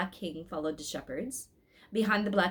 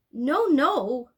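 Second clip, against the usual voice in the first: louder, higher tearful no; a child's upset protest; sobbing; 6 semitones higher, 7 dB louder than usual. A narrator's reading. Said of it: "sobbed Elizabeth".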